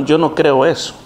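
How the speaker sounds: usual speaking voice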